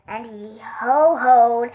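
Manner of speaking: ordinary delivery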